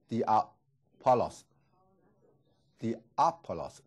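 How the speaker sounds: diapolos drawn out very slowly, four clear syllables; stressed syllable demo. Shown as a demonstration. Teacher showing where the stress falls, very slow. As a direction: lean slowly through diapolos. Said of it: "重音在".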